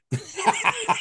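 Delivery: high burst of laughter; real laugh. Real, not acted. Man amused by his younger self, laughing hard. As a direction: crack up at your own folly.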